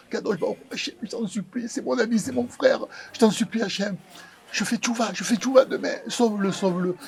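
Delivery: hushed, high pleading to God; begging Hashem softly, voice lifted and small; desperate prayer. Retold or imitated. Praying for his bleeding friend, a hushed high plea.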